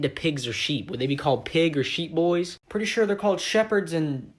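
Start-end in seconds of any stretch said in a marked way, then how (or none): none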